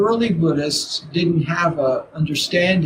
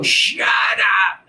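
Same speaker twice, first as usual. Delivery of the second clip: snapping shout; 4 semitones higher than usual. Retold or imitated. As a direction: yell at the barking dog after a bad day. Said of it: "And you go".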